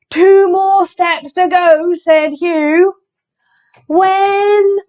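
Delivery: high, loud, drawn-out storytelling; animated read-aloud with mounting excitement; excited suspense. A narrator's reading. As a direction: stretch it out towards the big moment. Suspense building before the splash.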